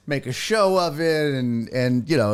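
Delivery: ordinary talk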